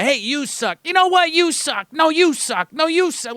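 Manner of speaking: mocking a playground spat between kids; childish squabble. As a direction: play two kids bickering. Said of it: "my, you know, niece or nephew coming back".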